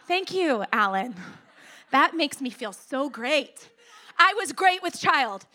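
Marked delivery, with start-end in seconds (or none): 0.1-3.7 loud, mock-grateful retort; sarcastic thanks. Pregnant pastor answering a tactless boy, mock sarcasm. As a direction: act out dry, emphatic false gratitude.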